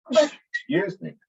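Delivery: high, slow, just after sneezing; recovering from a sneeze. Real, not acted. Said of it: "you may see me sneeze more than once".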